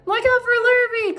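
very high warning call; cows calling out. A narrator's reading. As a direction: call a warning as an excited cow, pitched high. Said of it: "called the cows".

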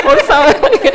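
high bursting laugh. Real, not acted. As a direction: laugh at yourself out loud. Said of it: "Did I sound like the beauty queen".

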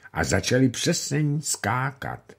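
usual speaking voice

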